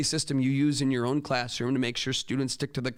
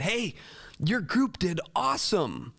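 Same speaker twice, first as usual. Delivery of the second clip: higher-pitched retold cheer for a student; upbeat praise; 7 semitones higher than usual. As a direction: congratulate brightly, drawing it out a little. Teacher retells praising a student.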